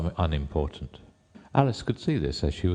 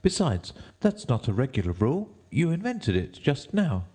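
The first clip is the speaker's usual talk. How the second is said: put-on high-pitched defiant girl; Alice; 8 semitones higher than usual. A narrator's reading. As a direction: refuse in a high girl's voice. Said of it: "said Alice".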